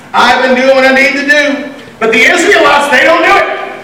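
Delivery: high-pitched complaining litany; mock self-pitying protest, pitched high; whining. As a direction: whine through a rehearsed list of grievances. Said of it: "whining to God".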